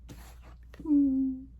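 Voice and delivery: delighted gasp. Real, not acted. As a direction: gasp at the card. Card reveal delights her.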